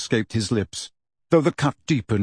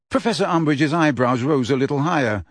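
plain reading voice

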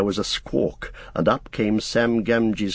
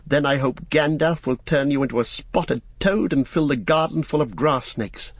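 higher-voiced young master threatening; Frodo, earnestly warning; 7 semitones higher than usual. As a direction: swear him to secrecy, higher pitch.

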